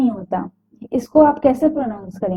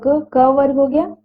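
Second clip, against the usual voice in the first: ordinary talk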